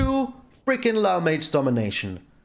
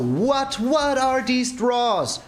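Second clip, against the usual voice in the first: high, slow, drawn-out disbelief; drawn-out exasperated whine; incredulous whine; 7 semitones higher, much slower than usual. Real, not acted. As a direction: stretch it out like you can't believe it. Opponent keeps drawing perfectly.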